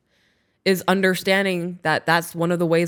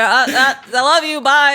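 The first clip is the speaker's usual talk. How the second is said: flustered goodbye; 5 semitones higher, 4 dB louder than usual. Retold or imitated. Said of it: "And I was like".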